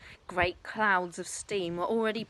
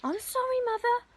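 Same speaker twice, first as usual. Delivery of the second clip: flustered daughter. Acted. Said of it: "Missus Cranky said".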